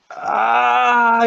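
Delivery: loud, high-pitched "ah" cry; drawn-out yelled "ah"; acted yell. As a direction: cry out in character, long and high. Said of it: "He's kinda yelling".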